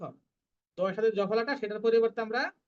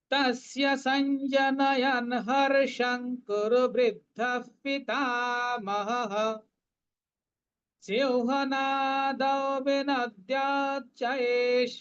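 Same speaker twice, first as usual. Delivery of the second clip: slow, higher chanting of the verse; verse recitation; 4 semitones higher, much slower than usual. Shown as a demonstration. Teacher chanting verse twelve for students. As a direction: chant it out slowly.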